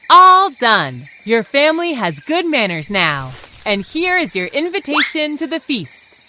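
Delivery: loud, proud announcer; loud announcement. Acted. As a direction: announce it triumphantly.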